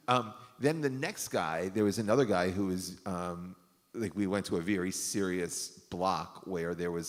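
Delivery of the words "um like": usual speaking voice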